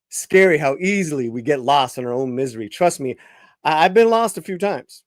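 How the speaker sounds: ordinary delivery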